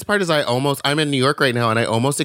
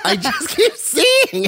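much higher, slow comic wail; mock despairing whine; slower than usual. Confessing seeing Wicked again in high comic wail. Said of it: "repeat, higher, mock despair".

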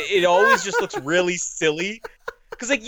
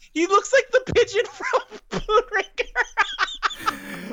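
laughing; much slower than usual. Real, not acted. Laughing while speaking.

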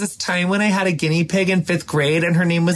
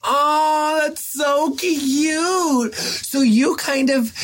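tender gushing; 11 semitones higher, much slower than usual. Real, not acted. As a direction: melt over something cute. Reacting to pregnancy story.

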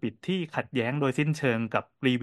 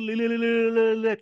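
mimicked rising din; 9 semitones higher, 3 dB louder than usual. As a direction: stretch the repeats high like a child's noise filling the shop. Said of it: "amplify เสียงขึ้น". (เลียนเสียงดังขึ้นเรื่อยๆ ลากยาวเสียงสูง)

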